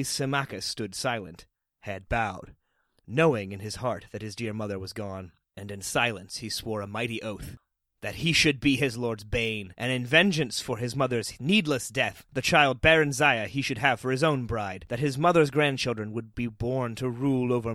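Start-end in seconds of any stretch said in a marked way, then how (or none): none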